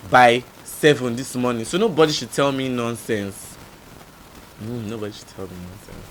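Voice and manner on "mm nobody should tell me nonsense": much softer repeat, as an aside; low aside. Real, not acted. Repeating own retort under the story, quiet aside. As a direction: drop the voice right down.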